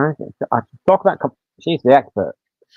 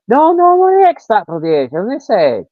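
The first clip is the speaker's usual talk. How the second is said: mock indignant protest; 8 semitones higher than usual. Barber protesting his age.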